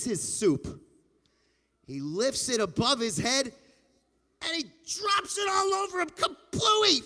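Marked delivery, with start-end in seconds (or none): 4.4-7.0 excited storytelling. A narrator's reading. Telling the soup-dumping moment.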